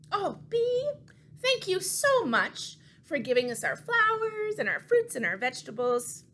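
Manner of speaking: high, gentle thanks to the puppet; cooing gratitude; sweet thanks. Acted. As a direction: thank the bee tenderly.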